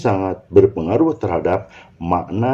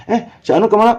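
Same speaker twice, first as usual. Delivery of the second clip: much higher-pitched casual question; casual friend asking; 13 semitones higher, 3 dB louder, faster than usual. Acted. Friend asking where someone went, casual high question.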